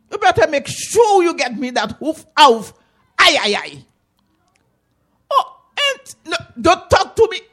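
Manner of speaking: high, loud angry scolding; furious auntie. Acted. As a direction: chase him off angrily. Auntie threatening beating.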